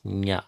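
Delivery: "nya" said slowly, the n melting into y; nya sound. Shown as a demonstration. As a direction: drag out the palatal "nya". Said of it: "starts with this very sound".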